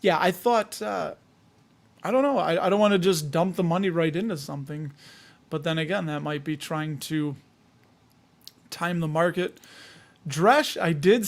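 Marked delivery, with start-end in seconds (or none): none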